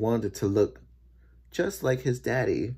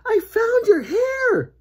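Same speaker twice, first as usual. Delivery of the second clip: slow, high, loud shout of discovery; mother yelling; 6 dB louder, slower than usual. A narrator's reading. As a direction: holler the news across the house. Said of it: "she yelled".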